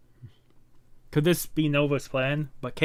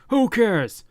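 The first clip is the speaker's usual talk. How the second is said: much higher, louder retort; angry outburst; 9 semitones higher, 6 dB louder than usual. Acted. Hero shouts down the worry, much higher and louder. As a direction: snap back in frustration. Heated argument over staying.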